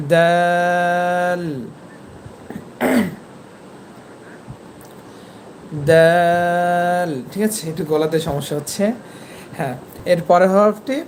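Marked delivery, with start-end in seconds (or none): 0.1-7.1 দাল with a hard dental d and very long vowel; tongue tip on tooth roots, sustained দাল; letter articulation demo. Shown as a demonstration. Teacher demonstrating the letter dal, long held দাল. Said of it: "দাল হরফটি উচ্চারণ করবেন".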